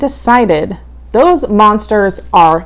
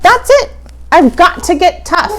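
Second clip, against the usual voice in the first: determined outburst; 6 semitones higher, 4 dB louder, faster than usual. Acted. Winifred decides to get tough.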